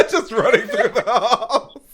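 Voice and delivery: high, loud, laughing delivery; real cracking-up, loud and high; laughing while talking. Real, not acted. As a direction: barely get it out through big laughter.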